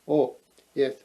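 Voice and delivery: usual speaking voice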